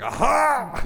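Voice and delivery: high, loud startled "aha"; retold yelp. Man retelling his surprise at her Spanish, high yelp. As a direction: let out a high-pitched yelp. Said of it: "I was just like".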